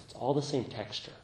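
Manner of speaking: ordinary talk, nothing marked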